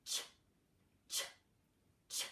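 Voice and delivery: Ch as short crisp ch bursts; ch sound. Shown as a demonstration. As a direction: tap out ch three times. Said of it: "our other new digraph".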